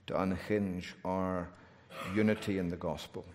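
soft, low prayerful voice. Acted. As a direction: pray quietly and earnestly. Closing prayer.

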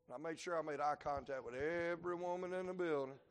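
normal talking voice

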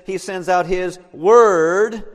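slow reading with "word" loud and drawn out; stress on word. Shown as a demonstration. Preacher quoting verse eighteen, stress on "word". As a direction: land heavily on "word". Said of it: "I emphasize the word word".

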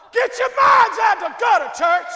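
high, loud mock rebuke at the congregation; mock scolding shout. Preacher playfully scolding the church with a loud shout. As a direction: yell it at the whole church, half joking.

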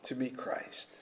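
breathy whispered question; hushed whisper to the congregation. Real, not acted.

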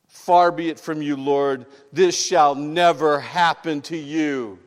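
loud, confident protest to the Lord; loud confident Peter; bold rebuke. Acted.

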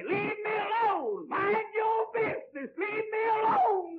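high, slow shouted demand; rebellious cry. Acted. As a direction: shout God away like a rebel. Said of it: "That's the cry of men today".

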